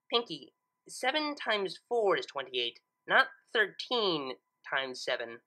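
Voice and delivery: slow, deliberate explanation; patient correction. Acted. Twilight patiently explains the maths to Pinkie, slowly. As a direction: spell it out slowly.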